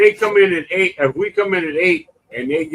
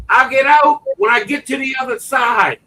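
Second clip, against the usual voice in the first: shouting back; 8 semitones higher, 5 dB louder than usual. Retold or imitated. Woman shouting back at lifeguards.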